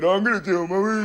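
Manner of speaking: mock little-brother whinge. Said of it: "Going to that mumbling moaning".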